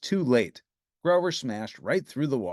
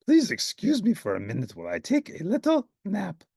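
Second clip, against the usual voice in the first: sleepy Grover; 8 semitones higher than usual. Acted. Grover tired.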